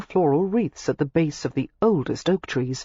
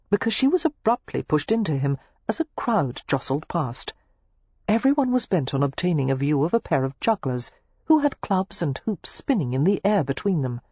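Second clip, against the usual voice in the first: ordinary delivery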